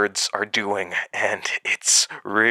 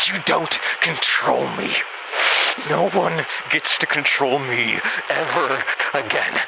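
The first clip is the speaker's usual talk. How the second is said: blowing up; 5 semitones higher than usual. Acted. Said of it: "I blew up there a little bit".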